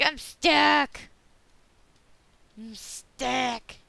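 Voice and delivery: high, loud hammed-up cry; mock panicked yell. Player reenacting being stuck, loud mock alarm. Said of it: "I was like".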